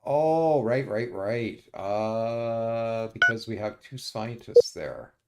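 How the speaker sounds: ordinary talk, nothing marked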